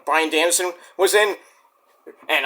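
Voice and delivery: ordinary talk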